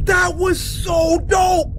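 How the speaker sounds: high, slow, hyped-up shout; excited shout. Real, not acted. Host hyping the horror short for viewers, excited shout. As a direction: yell it like the best video ever.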